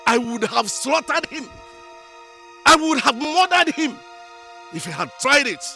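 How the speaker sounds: furious, climbing in pitch on the threat; outraged threat. Acted. Parent raging about the boy who nearly harmed the daughter, outraged.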